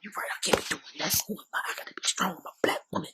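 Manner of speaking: hushed, whispery mock self-talk; whispered self pep talk. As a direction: replay an inner pep talk under the breath. Replays pep talk to herself.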